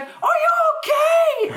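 high, slow, loud worried cry; panicked mom. Retold or imitated. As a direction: scream it out in panic. Mom panicking after accident.

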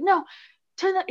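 put-on half-hearted European accent for a grandfather; mock European grandpa. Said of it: "he's European, I can't do a good accent".

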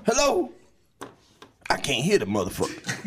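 put-on high-pitched, loud old OG on the phone; old uncle. Uncle leaving Steve a phone message, high old-man voice. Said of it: "can the uncle call Steve".

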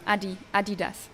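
Adidas with a quick, short Adi- at the front. Shown as a demonstration. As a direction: say Adidas the German way, AH-dee-das. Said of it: "So it's a quick Adi".

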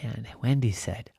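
ordinary delivery

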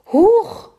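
very high, loud startled yelp; startled shriek. Acted. Farmer's wife startled by a rat, a high shriek. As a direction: shriek as a rat leaps at you. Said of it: "schrie Lisa".

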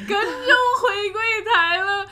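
high, slow and laughing, half sung; laughing sing-song. Real, not acted. Telling her cohost how he followed her, laughing sing-song. Laughing retelling his obedience.

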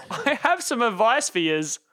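put-on laughing through a broad outback accent; mock Aussie country accent. Host reading listener email aloud in mock outback Australian accent. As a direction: ham up the bush Australian bloke. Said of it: "out in the country accent".

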